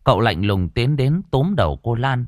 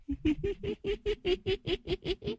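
high, breathy cackle of a ghost; a sly creepy titter; eerie ghost giggle; 13 dB quieter than usual. Acted. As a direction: ghost snickering before whispering. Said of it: "nhe răng ra cười cười".

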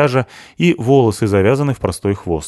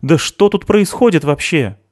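high, exasperated demand; shouting at someone; 10 semitones higher, 4 dB louder than usual. A narrator's reading. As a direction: demand an explanation with raised voice. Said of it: "закричала на неё Криспи". (возмущённо, повышая голос)

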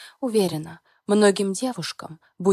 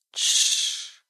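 shushing. Acted. Husband hushes her.

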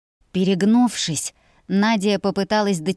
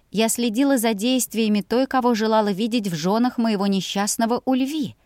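usual speaking voice

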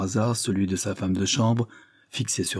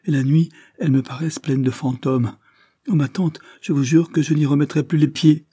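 higher, louder frightened plea; pitched-up nervous oath to her aunt; nervous tremble; 6 semitones higher, 4 dB louder than usual. A narrator's reading. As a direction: swear it, scared and pleading. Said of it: "avec un tremblement nerveux".

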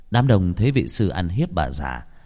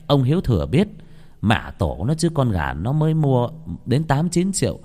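ordinary delivery

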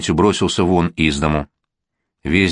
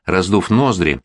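ordinary delivery

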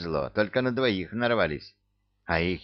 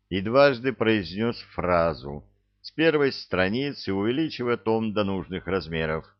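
ordinary delivery, nothing marked

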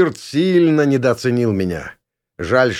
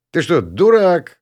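scornful loud question; 7 semitones higher, 4 dB louder, slower than usual. Acted. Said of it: "осведомился я".

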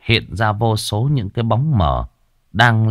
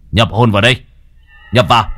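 rising, louder summoning cry; ritual call; 5 dB louder, much faster than usual. Acted. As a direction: call the spirit in with force. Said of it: "mà hô". (hô to gọi hồn nhập vào)

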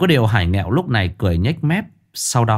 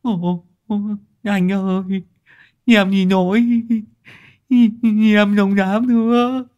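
much higher, whining plea; whimpering; 12 semitones higher than usual. A narrator's reading. Beaten gang boss begs Hải Nghẹo, whimpering high. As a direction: whimper for mercy like a kid. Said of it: "Thằng đại ca Sứt mếu máo".

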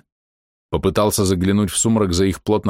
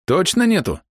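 raised-pitch questioning voice; higher, probing query; doubting question; 10 semitones higher than usual. A narrator's reading. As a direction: ask for confirmation, pitch raised. Hero questions her denial.

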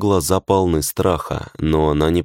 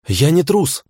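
shouted; 11 semitones higher than usual. A narrator's reading. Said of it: "выкрикнул я".

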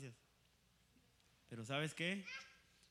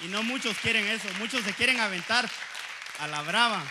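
loud preaching outburst; fiery preacher at full volume; preaching shout; 8 semitones higher, 17 dB louder than usual. Real, not acted. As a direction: shout it to the congregation.

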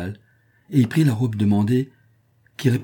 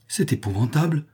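higher-pitched, mildly appalled cry; horrified exclamation; 6 semitones higher than usual. A narrator's reading. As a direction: exclaim in dismay. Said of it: "s'écrièrent quelques voix".

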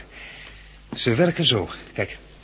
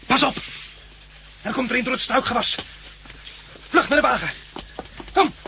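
alarmed shout; 11 semitones higher than usual. Acted. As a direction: yell at her to run. Triffid coming through the bushes.

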